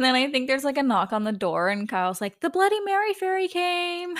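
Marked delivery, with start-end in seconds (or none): 2.4-4.1 mock excited announcement. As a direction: announce it high, imitating an excited friend. Acts out Kyle announcing a delivery.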